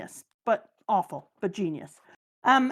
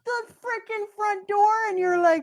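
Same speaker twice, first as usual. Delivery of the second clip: stunned excitement; 10 semitones higher than usual. Real, not acted. Fan reacting to a reveal, high gasping shout. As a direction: blurt it out in shock. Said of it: "excited outburst".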